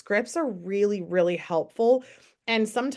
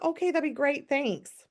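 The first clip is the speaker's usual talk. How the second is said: higher, light, polite thanks; breezy agreeable answer; friendly reply; 6 semitones higher than usual. Retold or imitated. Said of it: "they're like".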